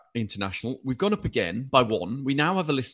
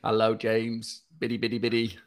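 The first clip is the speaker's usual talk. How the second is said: robot voice; 2 semitones lower than usual. Pretend. Greeting co-host as an emotionless robot, in robot voice. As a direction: put on a jokey robot voice, level and measured. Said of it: "so I did my robot voice".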